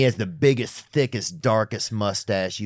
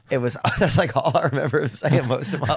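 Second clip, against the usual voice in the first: ordinary delivery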